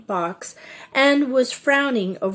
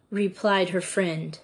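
usual speaking voice

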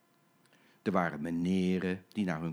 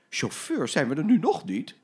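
impatient shopper; 4 semitones higher, 6 dB louder than usual. Acted. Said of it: "riep een mevrouw".

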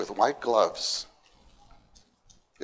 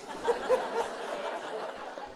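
high breathy laugh; 8 dB quieter than usual. Real, not acted. Laughs at own grandma act.

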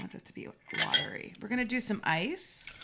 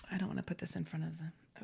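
whispering; 3 semitones lower, 9 dB quieter, faster than usual. Real, not acted. Hiding something from camera.

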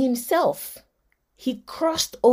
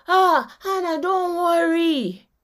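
consoling husband; 6 semitones higher, slower than usual. Retold or imitated. Hannah's husband consoling her.

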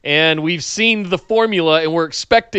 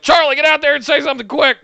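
higher-pitched, hurried producer impression; imitated urgent producer; 9 semitones higher than usual. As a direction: play a producer urgently pushing someone on air. Voices producers rushing Charlie on air.

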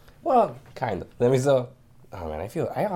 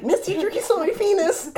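put-on high, slow childish tattle; 3 dB louder than usual.

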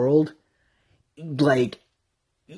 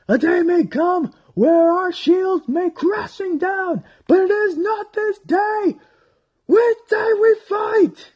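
high, slow, crying heroic rally; epic battle speech; 4 dB louder, slower than usual. Retold or imitated. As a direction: shout to the army to stand. Acting out Aragorn's battle speech.

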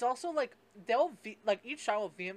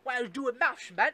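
mock British accent, higher and louder; 5 semitones higher, 10 dB louder than usual. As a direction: parody a smug Brit with a British accent. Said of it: "a British person will be like".

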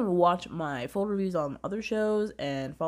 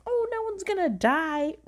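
high, silly self-mocking voice; mocking herself. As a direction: parody your own naive confidence in a high pitch. Mocking her own earlier naive confidence.

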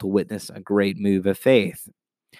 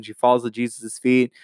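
normal talking voice

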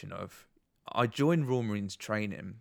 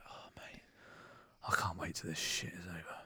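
hushed whisper to the lad beside him; 4 semitones lower, 10 dB quieter than usual. Retold or imitated. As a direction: whisper it sideways while standing in the ranks. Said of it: "I whispered to the lad next to me".

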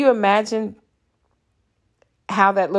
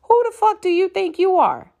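outraged; 10 semitones higher than usual. Real, not acted. Outraged at racist neighbors.

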